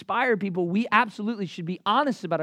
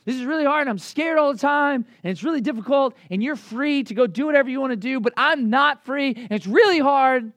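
Paul complaining; 7 semitones higher, 8 dB louder than usual. Retold or imitated. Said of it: "If Paul just was telling them".

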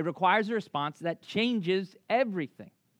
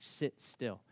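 much softer, tense command; hushed order; 5 semitones lower, 10 dB quieter than usual. Retold or imitated. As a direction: keep everyone still without a sound. Keeping still as shark passes.

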